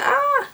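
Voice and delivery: high-pitched "ah" squeal; excited squeal. Retold or imitated. Viewer reacting to the couple pretending to be married, excited squeal. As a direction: squeal on a high, held note. Acts out own reaction to scene.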